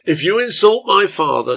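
loud, high, heated warning; angry threat. A narrator's reading. Son defending his father to the old man, threatening. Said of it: "balling his fists and waving them".

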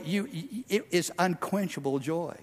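usual speaking voice

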